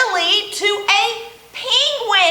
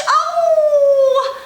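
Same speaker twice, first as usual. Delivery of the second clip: little penguin voice. Acted. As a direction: play the hiccuping little penguin talking to the kids. Librarian voices Little Penguin throughout.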